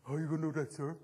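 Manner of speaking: mock a kid challenging the teacher, low and sullen; cheeky kid voice. Speaker imitating young kids questioning him, lightly mocking. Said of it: "And you know how they talk".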